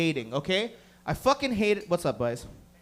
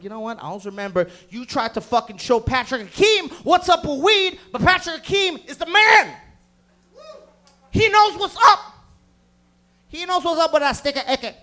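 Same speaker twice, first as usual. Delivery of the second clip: rising boastful shout; 6 semitones higher, 6 dB louder than usual. Retold or imitated. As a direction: build to a bragging yell. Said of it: "You're like".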